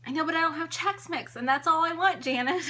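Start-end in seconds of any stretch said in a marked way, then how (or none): none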